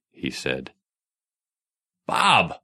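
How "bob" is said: sharp startled shout of a name. A narrator's reading. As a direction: call him out loud and sudden. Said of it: "Raymond shouted".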